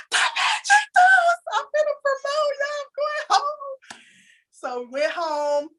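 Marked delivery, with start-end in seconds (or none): 0.1-4.0 much higher, slow, thrilled exclamation; excited squeal. Retold or imitated. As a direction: squeal it as the confetti falls. Confetti falls, she exclaims.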